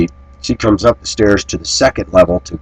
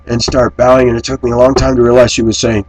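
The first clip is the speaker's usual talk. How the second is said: ordinary talk, nothing marked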